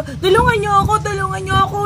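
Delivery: high, loud shout for help; retold cry for help. To the empty street at night, shouting for help. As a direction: yell for help as if wounded. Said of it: "Nagsisigaw ako".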